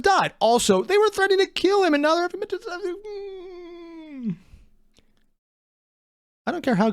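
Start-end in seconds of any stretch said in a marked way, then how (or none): 2.6-4.3 mock whimper. Mocking a pilot's breakdown.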